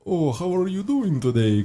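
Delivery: put-on high-pitched, friendly Russian-accented hello. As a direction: imitate dad with a light Russian accent. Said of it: "In the Russian accent".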